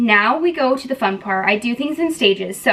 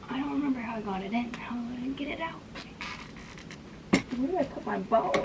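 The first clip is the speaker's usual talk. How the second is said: hushed whisper to herself; 12 dB quieter, faster than usual. Real, not acted. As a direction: whisper it, puzzled. Puzzling over the closet alone.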